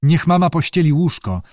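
plain reading voice

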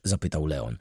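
ordinary delivery

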